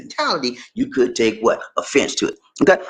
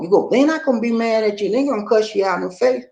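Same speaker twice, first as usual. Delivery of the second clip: usual speaking voice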